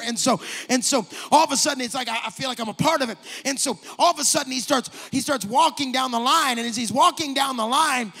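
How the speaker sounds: loud, rising preacher's build; preaching at full force. Acted. Preacher building the story to his church, loud and rising. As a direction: preach it with growing fire.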